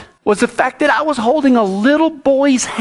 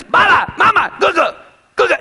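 excited mock child; 7 semitones higher, faster than usual. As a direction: call out Baba, mama, Gugu the way the boy does. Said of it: "he calls me Baba".

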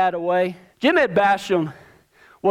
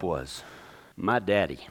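whispered reveal, much lower and softer; dramatic whisper; 13 semitones lower, 9 dB quieter than usual. Acted. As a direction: drop to a whisper for suspense. Big reveal of the last rider.

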